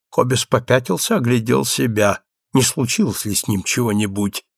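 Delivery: usual speaking voice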